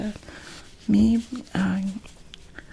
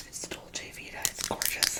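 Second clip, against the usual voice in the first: ASMR whisper; 9 dB quieter than usual. Real, not acted.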